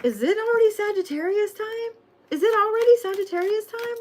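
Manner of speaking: high, squeaky mock-excited question; squealing excitement. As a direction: squeal it like a giddy fan. Mock-excited squeal at the Sagittarius reading.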